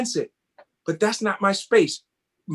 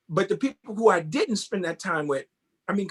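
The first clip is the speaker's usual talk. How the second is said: ordinary delivery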